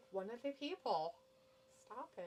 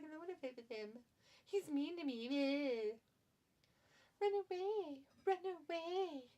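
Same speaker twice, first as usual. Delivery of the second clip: put-on whiny baby voice; 8 semitones higher than usual. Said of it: "But he's like".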